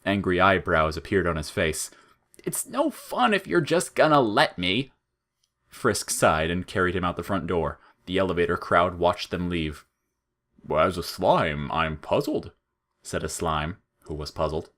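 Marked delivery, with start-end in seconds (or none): 2.4-4.9 high, petulant complaint; sulky flower voice. Acted. Flowey disappointed Frisk won't stop him, high and petulant. 10.7-12.5 slow, deadpan blob voice; slow slime voice. Acted. A slime musing to the crowd, slow and deadpan.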